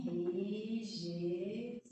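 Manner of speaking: drawn out slowly; teacher spelling it out slowly. Shown as a demonstration. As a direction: stretch each syllable clearly. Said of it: "Isso aí vem do verbo".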